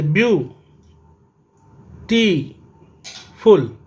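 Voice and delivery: Beautiful broken into three slow beats, view, टी, फुल; beautiful in three parts with long pauses; syllable by syllable. Shown as a demonstration. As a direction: stop after each syllable of beautiful. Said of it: "मैंने रोका और चलाया".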